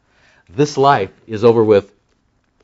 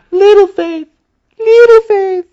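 little faith sung out much higher and louder; emphatic little faith; 6 dB louder, slower than usual. Shown as a demonstration. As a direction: lift little faith way up, drawn out. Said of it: "you have to say it that way".

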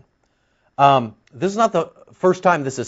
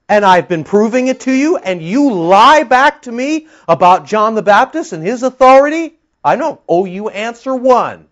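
louder and higher, building to a pitch on authority; rising rebuke; 5 semitones higher, 4 dB louder than usual. Acted. Jesus confronting the Pharisees over John, building to a loud rebuke. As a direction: press the accusation harder with every phrase.

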